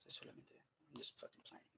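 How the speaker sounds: whispered, just kidding; whispered joke. Real, not acted. Admitting his Spanish is a joke, whispered. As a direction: let it slip out under your breath. Joking aside after Spanish.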